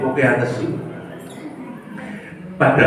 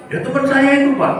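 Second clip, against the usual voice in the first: put-on higher, aggrieved young man; student's voice; 13 semitones higher than usual. Preacher voices his offended student answering him, higher and aggrieved.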